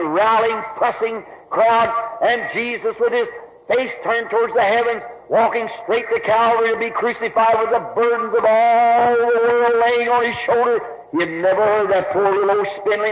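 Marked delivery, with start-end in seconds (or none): none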